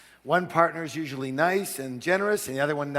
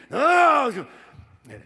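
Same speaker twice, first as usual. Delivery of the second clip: much higher, loud refusing shout; angry shout; 12 semitones higher, 9 dB louder, slower than usual. Retold or imitated. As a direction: yell no at your son. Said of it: "He goes".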